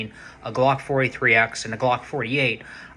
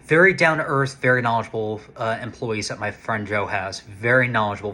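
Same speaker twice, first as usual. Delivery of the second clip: usual speaking voice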